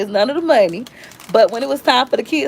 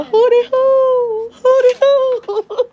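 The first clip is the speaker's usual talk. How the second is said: high, slow hootie hoo through sobs; a lost little kid wailing the call; hammed-up tearful hootie hoo; mock crying; 4 dB louder, much slower than usual. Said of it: "walking around crying".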